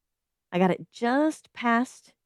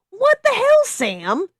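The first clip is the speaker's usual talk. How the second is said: very high, loud shout; 8 dB louder, slower than usual. A narrator's reading. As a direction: scream it in disbelief. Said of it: "I asked again".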